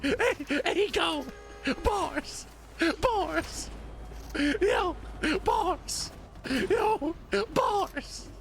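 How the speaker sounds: hyped high-pitched shouts of bars; hype shouting. Real, not acted. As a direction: yell it like a hype man at a rap battle. Hyping up the AI's rap.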